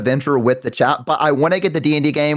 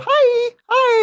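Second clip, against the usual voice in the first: very high, cutesy group greeting; chat saying hi. Acted. Streamer voicing chat greeting a newcomer, high squeaky hi. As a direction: squeak out two little hellos. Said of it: "and they go".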